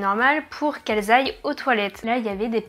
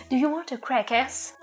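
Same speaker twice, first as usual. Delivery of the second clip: American host mom English; 3 semitones higher than usual. Retold or imitated. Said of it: "Avec mon sublime accent".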